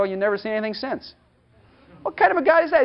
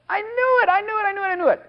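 high, sing-song teasing repeat; a light, high-pitched God who knew all along; God's playful I-knew-it; 11 semitones higher than usual. Acted. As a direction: tease like a knowing God. God voiced, gloating.

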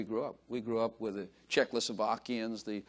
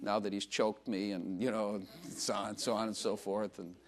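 quoting the sister; 3 semitones lower, 6 dB quieter than usual. Retold or imitated. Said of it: "She said".

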